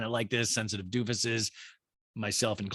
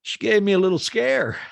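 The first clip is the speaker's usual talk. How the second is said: higher and louder, a half-laughing mock guy voice; sheepish chuckling quote; 8 semitones higher, 6 dB louder than usual. Host quoting Shep about a pregnancy scare, amused mock voice. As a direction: play it off like a near miss. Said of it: "laughs, shakes his head and goes".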